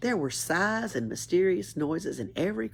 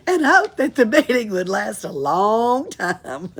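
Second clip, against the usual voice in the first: louder, high, laughing through the words; laughing while reading; 7 semitones higher, 9 dB louder than usual. Real, not acted. Reader giggling through the narration of Annika's relief. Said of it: "Bet she did".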